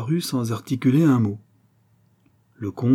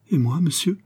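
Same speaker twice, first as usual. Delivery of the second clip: higher, a touch of anguish; anguished cry; 6 semitones higher than usual. A narrator's reading. The groom cries out to his master, fearing dismissal. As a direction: cry out in sudden worry. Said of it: "s'écria-t-il avec angoisse".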